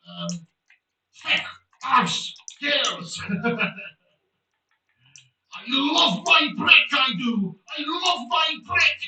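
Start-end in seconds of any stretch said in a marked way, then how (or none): 1.2-9.0 loud, slow bellowed outbursts; Father Jack impression. Streamer answering a chat request, shouted Father Jack impression. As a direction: bark each word, then roar slowly. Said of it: "done a Father Jack impression".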